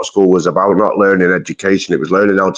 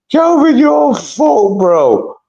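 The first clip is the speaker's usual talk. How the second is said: high slow shout; 3 dB louder, much slower than usual. Real, not acted. Mate shouting at his friend Kelvin, drawn-out high call.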